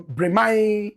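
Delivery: Bremen with a long open "mai" ending; mispronounced Bremen. Real, not acted. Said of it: "not Bremen".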